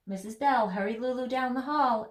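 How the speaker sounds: ordinary delivery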